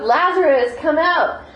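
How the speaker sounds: high, loud call of a name; showing how loud the call was; loud shout. Shown as a demonstration. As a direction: shout the name out across the tomb. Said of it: "shouting, saying it really loud".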